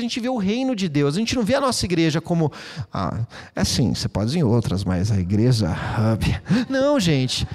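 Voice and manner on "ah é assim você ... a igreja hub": much lower, slow, offhand mocking tone; mock dismissive voice. Pastor mocks outsiders calling his church a hub, low and dismissive. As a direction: drop low and sneer it off.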